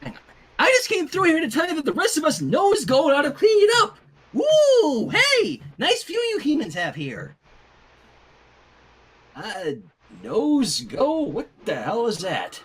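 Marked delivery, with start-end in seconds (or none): 0.6-7.4 very high, loud hyper pony voice; high excited squeal then gushing; bubbly Pinkie Pie. Acted. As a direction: whoop and gush like a giddy party pony. Pinkie excited in human world.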